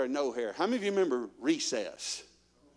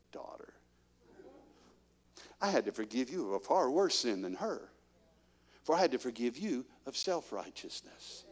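gentle voice of the Lord; 2 semitones lower, 6 dB quieter than usual. Retold or imitated. Preacher voicing the Lord gently correcting his daughter.

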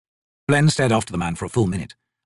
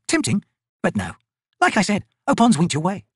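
lightly raised soldier voice; brisk, higher woman soldier; Picker's voice; 9 semitones higher than usual. Acted.

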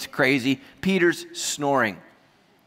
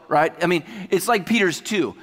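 usual speaking voice

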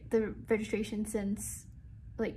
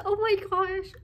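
excited; 9 semitones higher, 4 dB louder than usual. Real, not acted. Hears she can leave early.

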